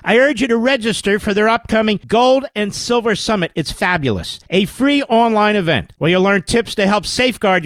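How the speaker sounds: loud, high, pushy pitchman read. Acted. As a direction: sell it hard like a radio ad. Host reads sponsor ad.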